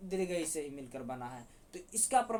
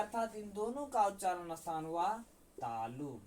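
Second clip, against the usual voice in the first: ordinary talk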